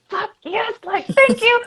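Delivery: high, thrilled yelp of relief; excited outburst. Retold or imitated. Speaking to her guest about excuses, a high excited cheer. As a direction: cheer it like a long-awaited answer. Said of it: "I was like".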